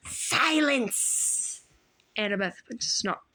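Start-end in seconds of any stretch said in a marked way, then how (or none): 0.1-1.6 snarled. A narrator's reading. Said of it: "Medusa snarled".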